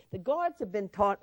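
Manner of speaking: ordinary talk, nothing marked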